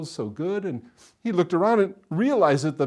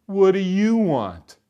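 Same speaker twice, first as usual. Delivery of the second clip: slow, loud, higher-pitched challenge; rude little boy; 5 semitones higher, 7 dB louder, slower than usual. A narrator's reading. Little boy answering a soldier's knock, rude and loud. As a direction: drag out a rude demand.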